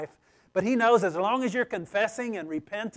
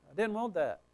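softer, muted protest; subdued objection; 7 dB quieter than usual. Acted. Reader balking at what Scripture says, subdued reluctance. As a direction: say it under the voice, reluctantly. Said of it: "It's like".

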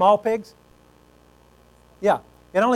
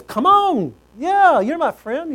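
eager invitation; 8 semitones higher, slower than usual. Acted. Son voiced eagerly inviting pig.

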